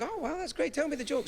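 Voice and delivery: high, thrilled reaction; excited. Retold or imitated. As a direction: gush like you just heard great news. Retelling excited reaction to friend.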